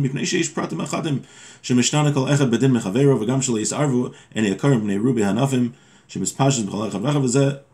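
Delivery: ordinary talk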